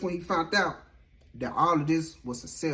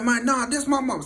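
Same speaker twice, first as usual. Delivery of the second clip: raised-pitch imitation of a man protesting; acted desperate protest; 8 semitones higher, 4 dB louder than usual. Beaten man protests at gunpoint.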